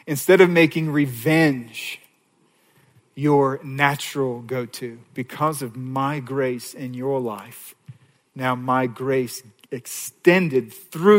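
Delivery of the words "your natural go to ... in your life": ordinary talk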